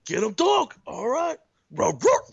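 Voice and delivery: loud, high put-on rapper bark. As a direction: bark it out like a hyped-up rap star. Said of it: "keep thinking D M X".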